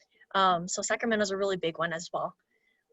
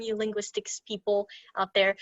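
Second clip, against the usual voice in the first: ordinary delivery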